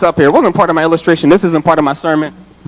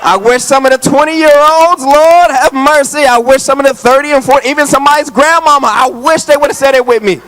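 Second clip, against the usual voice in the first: high, loud exhortation at full force; loud preaching; 8 semitones higher, 4 dB louder than usual. Real, not acted. Preacher chiding silent congregation, loud and high. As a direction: call it out, pleading for a response. Preacher urging crowd to respond.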